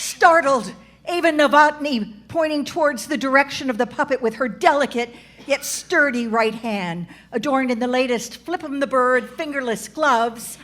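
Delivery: mock narration. A narrator's reading. Narrator describing Ava's startled point, brisk narration. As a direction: narrate the action breathlessly.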